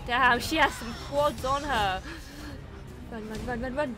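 panicked yelling while fleeing; being chased. Real, not acted. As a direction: shout it out running for your life.

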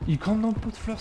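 high indignant repeat of the callout; outraged callout. Real, not acted.

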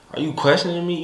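a mock stern "are you questioning me"; coming off strong. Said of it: "Coming off real strong".

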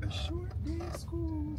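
high mimicking of a kid's voice; imitating child. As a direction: echo the kid in a high voice. Dad mimics child's answer.

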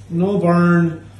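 ordinary talk, nothing marked

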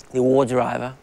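usual speaking voice

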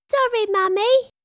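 squeaky little bear apologizing; Baby Bear voice. A narrator's reading. Baby Bear to Mummy Bear, small high apology.

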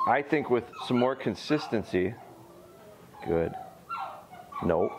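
ordinary delivery

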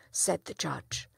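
plain reading voice

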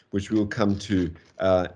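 normal talking voice

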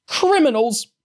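put-on old woman screaming at the children. A narrator's reading. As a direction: scream the word as an accusation. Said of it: "the woman screamed again".

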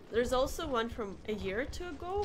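normal talking voice

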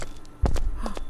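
breathless sharp gasp; frightened gasp. Real, not acted. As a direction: suck in air in fear. Sees the monster again.

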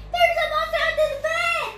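fast, high, crying voice. Acted. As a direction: sob out the line in a rush. Child acting scared story character.

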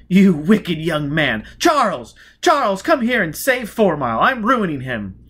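high and loud, slowed on the scolding; mock-scandalised lady calling out. Lady Shrapnel calling her husband over, high and loud. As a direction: call across the ballroom in delighted outrage.

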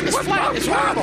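lost pilot voice. Acted.

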